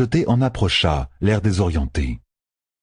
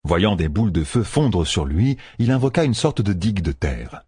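plain reading voice

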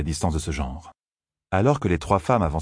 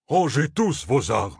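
higher, slow indignant outburst; indignant cry; 7 semitones higher, slower than usual. A narrator's reading. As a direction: scold the armed groups in outrage. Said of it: "frémissant d'indignation". (cri indigné, lent et plus aigu)

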